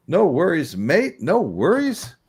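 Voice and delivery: imitating a friendly Australian; playful Aussie impression; Australian accent.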